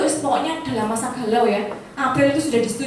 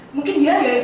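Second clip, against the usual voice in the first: ordinary talk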